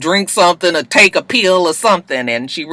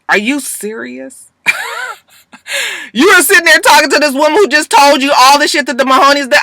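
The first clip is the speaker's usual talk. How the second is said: high, fast incredulous rant; can't believe what she's hearing; outraged disbelief; 12 semitones higher, 3 dB louder than usual. Real, not acted. Vlogger ranting at Laurel in disbelief, high and fast. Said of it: "vlogger's own outraged reaction".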